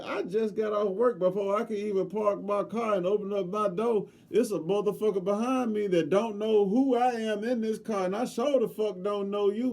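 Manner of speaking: high, loud furious telling-off; angry rant. Retold or imitated. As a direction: shout him down, word after word. Telling off the employee.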